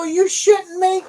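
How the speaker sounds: very high, loud, slow outraged question; stunned disbelief. Retold or imitated. Trucker reacting to having to hand load, stunned and loud. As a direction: drag it out in shock. Retold disbelief at dock clerk.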